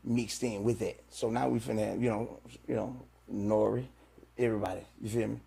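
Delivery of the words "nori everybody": usual speaking voice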